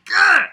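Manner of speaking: loud, high cartoon growl; goofy Peter Griffin style growl; mock growl. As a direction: growl like a doofus cartoon dad.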